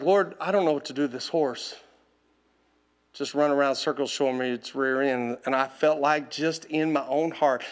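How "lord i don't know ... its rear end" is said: low, subdued admission; quiet prayer. Retold or imitated. Owner telling the Lord about his horse, quiet and low. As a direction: softly confide a problem, voice dropped. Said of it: "I'm like".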